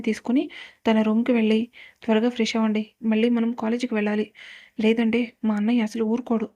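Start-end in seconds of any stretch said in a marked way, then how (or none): none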